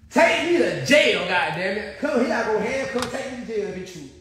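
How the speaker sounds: a hammed-up, laughing take-me-away plea; mock plea. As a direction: whine it like you're begging to be arrested, half laughing. Mocking the accusation, laughing.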